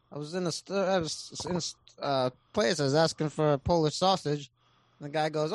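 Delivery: usual speaking voice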